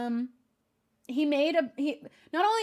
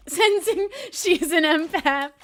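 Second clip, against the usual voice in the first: higher, louder, slow, through laughter; laughing; 5 semitones higher, 8 dB louder, slower than usual. Real, not acted. Streamer teasing herself about being an empath, laughing.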